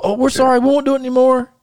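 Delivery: mock kid apology. Kids apologising to mom.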